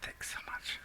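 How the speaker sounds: dry whispered thanks; a sour whispered aside. Real, not acted. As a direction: breathe the thanks sarcastically. Sarcastic whispered reply to GM.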